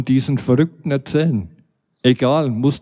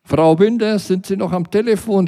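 raised, high call through a closed door; shouting; 7 semitones higher than usual. Retold or imitated. Narrator shouts through the closed door to the phone lady. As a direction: yell it toward the phone inside. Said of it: "schrie ich durch die geschlossene Eingangstür".

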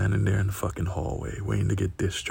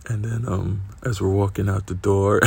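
ordinary talk

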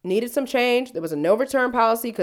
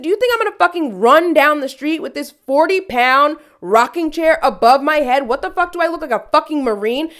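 angry retort; 7 semitones higher, 6 dB louder than usual. Retold or imitated. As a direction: tell the owner off, outraged. Retold angry clapback at owner.

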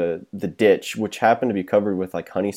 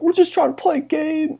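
high, put-on pleading voice; pleading kids. Teens pleading with the gunman, mock pleading. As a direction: beg like a scared teenager. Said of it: "We're like".

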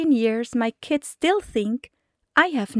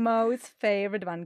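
ordinary talk, nothing marked

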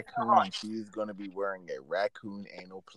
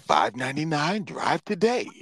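mock car-ad voice, rising higher; commercial announcer; 6 semitones higher than usual. Imitating car commercials for friends, high announcer voice. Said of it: "how some of the commercials say".

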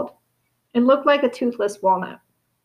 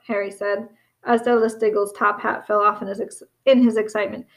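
usual speaking voice

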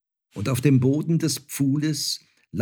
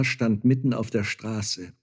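plain reading voice

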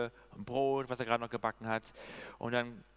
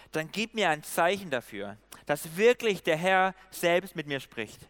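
louder, raised, declaimed Bible reading; emphatic scripture reading; 2 semitones higher, 5 dB louder than usual. A narrator's reading. Preacher reads Gideon asking the Lord for a sign, emphatic reading. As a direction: read the verse out with weight on key words.

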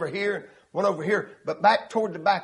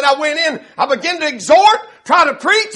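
rising, louder pulpit delivery; preaching build; 8 semitones higher, 9 dB louder than usual. Retold or imitated. Preacher retells a young exhorter's first try, rising preaching voice. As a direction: lift each phrase higher and stronger.

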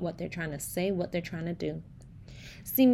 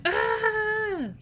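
high, held dinosaur "ah"; sustained mock dinosaur yell; louder dinosaur noise; 4 dB louder than usual. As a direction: play a dinosaur cry, stepped up. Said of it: "say even louder".

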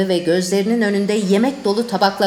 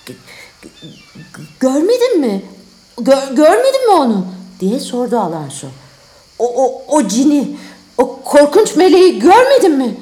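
shaken, stuttering, louder questions; stammering fear; 4 semitones higher, 6 dB louder than usual. Acted. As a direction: stammer in terror. Terrified king after vision.

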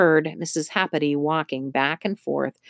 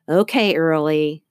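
usual speaking voice

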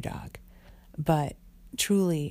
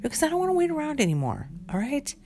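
much higher, fast exasperated reply; mock fed-up yelp, higher and fast; exasperated retort; 10 semitones higher, faster than usual. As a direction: snap back at him, fed up. Retold angry retort to husband.

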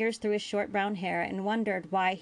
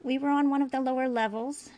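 put-on higher-pitched, reluctant boy's voice; slow explaining; 6 semitones higher, 4 dB louder than usual. A narrator's reading. Boy explains his injury to the doctor, slowly. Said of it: "Jake explained so slowly".

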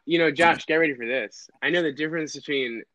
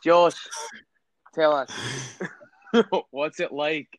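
put-on Scottish podcast intro; host the show in a Scottish accent, rising at the end; slower than usual. Shown as a demonstration. Host welcomes Josh Baker to the podcast, Scottish accent. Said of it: "I can do Scottish".

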